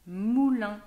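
emphatic loud word. Shown as a demonstration. Teacher to child, announcing the next word: loud and emphatic.

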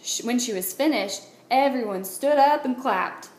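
plain reading voice

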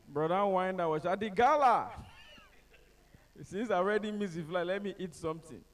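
ordinary delivery

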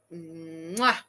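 exaggerated "mwah" kiss sound; mock kiss. Streamer pretending to admire a photo, mock kiss. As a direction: smack a low mock chef's kiss. Sarcastic praise of the picture.

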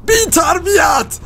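high, slow outraged shout; priest shouting. A narrator's reading. Priest yelling at rude Roy, high and slow. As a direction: yell it like an offended priest. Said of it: "کشیش فریاد زد".

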